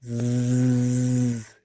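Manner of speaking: a long buzzing zzz; z sound. Shown as a demonstration. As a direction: hold a voiced z buzz. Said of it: "esse som do". (som de z prolongado)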